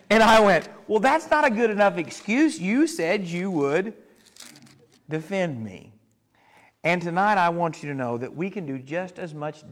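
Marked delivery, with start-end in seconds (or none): 0.9-5.9 a parent half-joking at a son; mock scolding. Scolding son in retold story.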